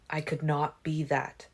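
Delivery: ordinary delivery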